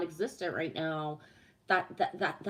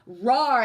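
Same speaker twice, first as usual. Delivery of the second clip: playful roar; 3 semitones higher, 10 dB louder than usual. Pretend.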